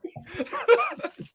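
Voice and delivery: high-pitched laugh. Real, not acted. Reacts to rigged draft order.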